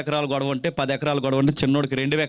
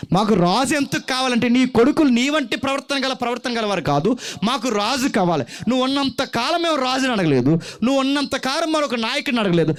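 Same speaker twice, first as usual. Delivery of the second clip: higher and louder, an insistent demand; crowd demanding a king; 9 semitones higher, 3 dB louder than usual. Retold or imitated. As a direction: voice the people's loud demand for a king.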